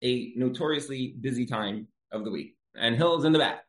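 ordinary delivery

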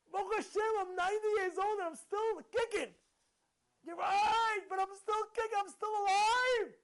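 high, loud, triumphant cry; exultant gratitude. Acted. Ninety-year-old thankful to still be alive, exultant. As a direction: proclaim it joyfully, stretching the last words.

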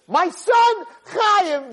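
high, loud parental outburst; screaming. Acted. Parent starting to scream at his son, screaming. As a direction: open at a screaming pitch on the relationship. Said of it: "it comes down ten octaves".

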